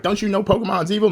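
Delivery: mock her shocked question; church lady. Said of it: "she's like".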